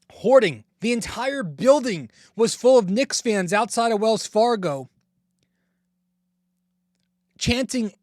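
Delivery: excited. Real, not acted. Fired-up rant about rival fans.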